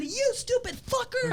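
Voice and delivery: high-pitched retold outburst; retold angry yell. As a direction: re-enact yelling an insult, voice shooting up. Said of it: "And all I'm saying".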